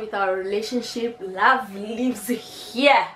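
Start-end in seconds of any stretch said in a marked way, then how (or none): 1.2-3.1 mock-dramatic motto. Sums up story, drawn out.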